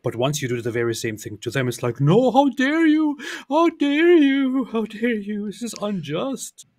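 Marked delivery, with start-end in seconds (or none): 2.0-6.7 high, drawn-out indignant wail, loud then fading; mock outrage. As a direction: imitate someone hugely affronted, pitched way up and slow. Mocks outraged reaction to same act.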